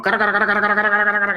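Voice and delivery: high, loud, rushed nonsense syllables standing in for fast speech; mock gibberish. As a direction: rattle off meaningless sounds like a speaker you can't follow. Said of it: "I'm going".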